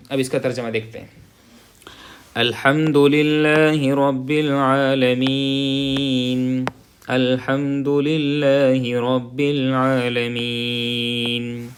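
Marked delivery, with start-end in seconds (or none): 2.3-11.7 slow, drawn-out tajweed recitation; Quranic recitation. Shown as a demonstration. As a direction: chant each word slowly. Recites before translating. (ٹھہر ٹھہر کر تلاوت)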